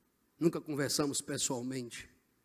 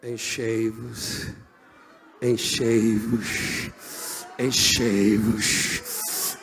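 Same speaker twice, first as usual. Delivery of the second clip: very slow, stretched words growing louder; building exhortation; 3 semitones lower, 6 dB louder, much slower than usual. Real, not acted. Preacher repeating his call to be filled, slowly building. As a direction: draw each repeat out longer and push it harder.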